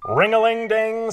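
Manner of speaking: loud ringing alarm imitation; loud, sing-out alarm sound; alarm bell. A narrator's reading. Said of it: "sounds the alarm".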